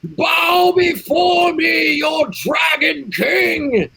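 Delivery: high, slow booming command; commanding shout. Acted. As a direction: bellow it like a tyrant king. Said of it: "and I say".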